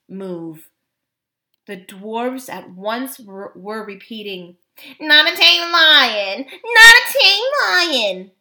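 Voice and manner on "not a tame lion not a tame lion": high, loud, slow taunting chant; sing-song mockery at full voice; jeering sing-song. A narrator's reading. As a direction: chant it mockingly like a playground taunt. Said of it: "in a jeering, sing-song voice".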